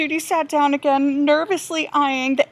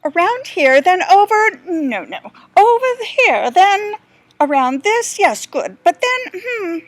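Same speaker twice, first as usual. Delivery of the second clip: higher, fussy explorer musing over his map; a lost guide talking to himself, a little higher; dithering guide; 5 semitones higher, 6 dB louder than usual. Acted. The guide mutters over his map, lightly voiced and dithering.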